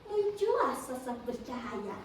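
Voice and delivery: ordinary delivery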